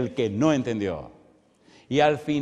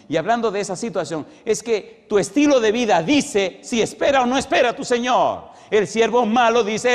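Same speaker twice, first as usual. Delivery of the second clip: loud, rising sermon exhortation, pushing hard; full-force preaching; 5 semitones higher, 6 dB louder than usual. Acted. Preacher urging the congregation to prepare now, full-force preaching. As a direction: preach it out, warn them to get ready.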